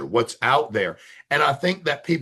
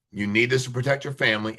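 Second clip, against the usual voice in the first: usual speaking voice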